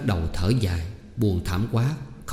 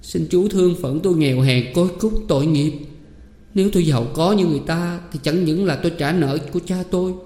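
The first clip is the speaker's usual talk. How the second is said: much higher, begging from the floor; pleading; 7 semitones higher than usual. A narrator's reading. Orphan girl begs her uncle for mercy, pleading. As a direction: plead for pity, humbled. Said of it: "ngồi bẹp xuống đất ngay trước mặt Đỗ Cẩm".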